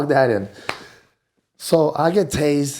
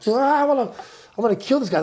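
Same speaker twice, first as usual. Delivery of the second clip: higher, half-joking frantic threat; hammed-up frustrated yelp, pitched high; imitating his own frantic self, higher; mock frantic outburst; 12 semitones higher, faster than usual. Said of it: "I started being like".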